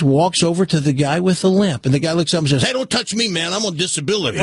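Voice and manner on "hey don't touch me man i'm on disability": high, urgent comic panic; alarmed protest. Acted. Limping man stopping the healer from touching him, alarmed. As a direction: blurt it out to ward off the healer.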